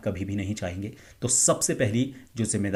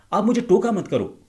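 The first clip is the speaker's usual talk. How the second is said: shouted high and loud for aggression; high aggressive pitch; 10 semitones higher, 5 dB louder than usual. Shown as a demonstration. A speaker contrasting a calm take with an aggressive high-pitched take. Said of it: "आपने उस high pitch को पकड़ा".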